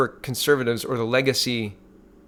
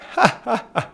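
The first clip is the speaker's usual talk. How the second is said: high burst of laughter; incredulous high laugh; scoffing laugh; 9 semitones higher than usual. Real, not acted. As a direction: laugh out at the absurdity. Laughs at reading "defend his record.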